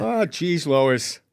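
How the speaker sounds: put-on cartoon character voice; Peter Griffin. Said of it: "I was trying to sound like Peter Griffin".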